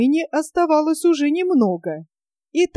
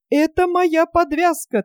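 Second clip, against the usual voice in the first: a mild solemn announcement, pitched higher; 6 semitones higher than usual. A narrator's reading. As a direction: proclaim it like a verdict. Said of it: "торжественно сказал старик Яков".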